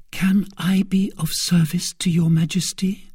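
gentle offer in a whisper; sweet, pitying whisper. A narrator's reading. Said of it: "a voice full of sweetness and pity".

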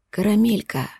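tender. Acted. Lover's tender call.

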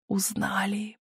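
whispered gleeful aside. A narrator's reading. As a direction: drop to a secret whisper. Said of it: "припевая в такт стуку колёс".

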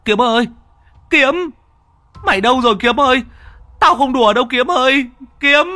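high, loud desperate shouting. Acted. Đức shouts for his missing friend, desperate. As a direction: scream his name across the water. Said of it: "nghển cổ lên mà gào".

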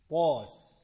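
"طاس" punched loud and heavy; booming heavy "طاس"; loud emphatic syllable. Shown as a demonstration. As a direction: hit "طاس" hard and full. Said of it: "قرطاس demo".

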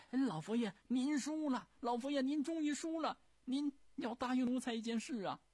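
高声兴奋地嚷，赢了赌; 冲着老佛爷大声报喜; 得意地喊. Acted. 李莲英得意宣布慈禧输了. (高调兴奋地喊“您输了”)

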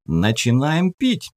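put-on high, slow cocky young voice; cheeky teen. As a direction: show off the wine, grinning. Teen showing off cheap wine.

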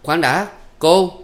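high, drawn-out call; calling back. A narrator's reading. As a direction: call out urgently to stop her. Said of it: "ba Lành đã kêu giật ngược lại". (gọi giật lại, giọng cao, kéo dài)

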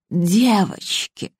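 put-on slow, wheezing old woman's voice; hoarse wheeze. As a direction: rasp it out, choking. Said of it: "прохрипела Розалия".

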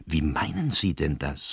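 usual speaking voice

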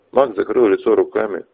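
ordinary delivery, nothing marked

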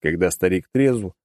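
ordinary delivery

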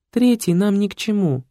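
high, plaintive woman's voice; weepy feminine protest; almost crying girl. A narrator's reading. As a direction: whine it, near tears. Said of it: "уже почти плакала девушка".

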